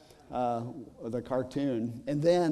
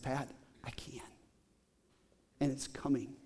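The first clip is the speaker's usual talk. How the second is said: gentle reassurance; 2 semitones lower, 10 dB quieter than usual. Retold or imitated. Pastor gently answers grieving woman.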